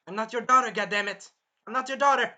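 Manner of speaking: loud, high exasperated protest; indignant retort. Acted. Raphtalia answering Naofumi in her head, indignant and loud.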